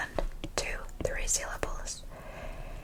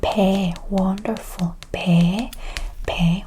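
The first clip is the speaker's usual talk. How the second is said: Pe voiced and much louder; clear loud demo; 8 dB louder, slower than usual. Shown as a demonstration. Teacher modelling Pe loudly for learners. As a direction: hit Pe clearly. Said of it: "We both pronounce as e".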